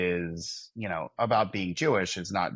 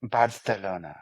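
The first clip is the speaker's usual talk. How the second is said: sultry fake Spanish. Cohost dares him to say it like Hilaria Baldwin, sultry fake Spanish accent. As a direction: purr "Barcelona" in a put-on Spanish accent. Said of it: "say Barcelona like Hilaria Baldwin".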